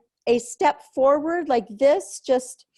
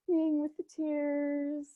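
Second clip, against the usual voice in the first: calm them down after the storm; gentle hushed comfort; soothing; 3 semitones higher, 8 dB quieter than usual. Real, not acted. Calming after release.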